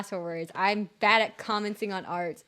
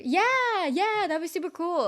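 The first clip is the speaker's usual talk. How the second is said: high, excited burst of yeah; loud high start, settling slightly; eager agreement; 10 semitones higher, 5 dB louder, slower than usual. Real, not acted. Streamer eagerly agreeing to see finished art, excited.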